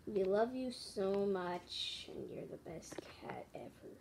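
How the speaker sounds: slow, fading to a near whisper; tender farewell. Pretend. Plushie tribute to Rita in heaven, tender farewell. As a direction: say it slowly, letting it sink away softly.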